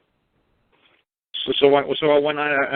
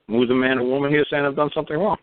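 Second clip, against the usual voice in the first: ordinary talk, nothing marked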